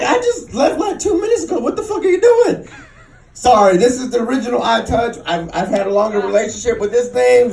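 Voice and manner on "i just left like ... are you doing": high-pitched, mildly indignant wife voice; annoyed wife. Retold or imitated. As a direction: lightly voice a wife's incredulous demand. Wife catching him in the act.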